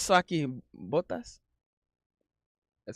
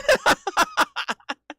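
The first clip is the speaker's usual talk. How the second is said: very high squealing laugh. Real, not acted. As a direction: burst out giggling. Laughs at pitch-shifted clip.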